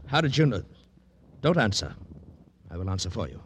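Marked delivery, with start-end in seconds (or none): 0.1-1.9 loud, higher, sudden alarm; startled outburst. Acted. Yogi startled that Danny knows about Cynthia, loud and high. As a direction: caught off guard, blurt it out.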